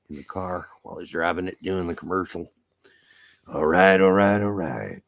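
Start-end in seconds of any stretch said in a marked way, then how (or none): 3.5-5.0 intimate, low mock actor catchphrase; Matthew McConaughey. Host mimics a car commercial spoof, intimate actor impression. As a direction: croon it low and close, parodying the actor. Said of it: "a little too intimate to my ears".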